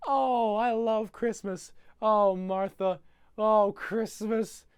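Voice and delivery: high, loud, drawn-out swooning; high slow dreamy gushing kid; mock rapture. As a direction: swoon over it slowly and high. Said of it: "he goes".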